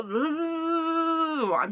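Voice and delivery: long exasperated groan of I'm just mad; drawn-out fury. Real, not acted. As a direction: drag it out in exasperated rage. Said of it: "I am shaking with rage".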